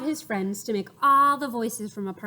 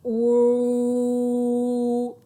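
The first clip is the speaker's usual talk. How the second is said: louder long booming ooh; big voice; 7 dB louder than usual. Shown as a demonstration. Showing the big voice to the children. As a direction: fill the room with it. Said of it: "use your big voice".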